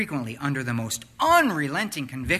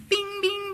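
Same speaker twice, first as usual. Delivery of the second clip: high, pinging imitation of a dashboard chime; ping it out like a car warning light; warning chime bings; 4 dB louder than usual. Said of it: "comes with those annoying little sounds".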